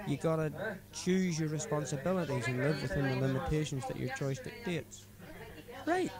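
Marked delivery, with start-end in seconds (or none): 0.1-5.1 put-on higher-pitched drunken friend preaching life advice; drunk mate Paddy. Drunk driver lecturing his passenger about responsibility, higher voice. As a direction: lay out your drunken philosophy earnestly.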